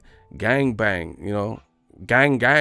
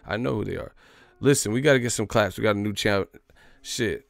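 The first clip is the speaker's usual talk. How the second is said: ordinary delivery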